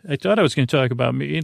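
normal talking voice

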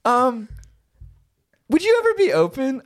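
put-on high, hesitant girlfriend voice; mock feminine, tentative question; acted girlfriend. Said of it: "And she goes".

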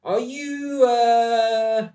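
rakish sailor William. Acted. William leaning on the bulkhead, chatting up Dorothy: high, loud, showy. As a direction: play the smug charmer name-dropping a famous friend. William bragging, then his nerve fails.

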